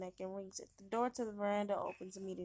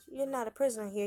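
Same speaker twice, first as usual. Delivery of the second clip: usual speaking voice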